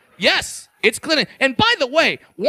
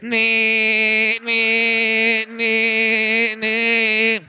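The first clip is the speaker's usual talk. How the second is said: long, monotone mechanical whine; imitated wheelchair motor; much slower than usual. Comic acts out an automatic wheelchair rolling, droning motor noise. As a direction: drone steadily like an electric motor.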